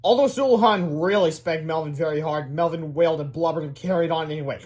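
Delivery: ordinary delivery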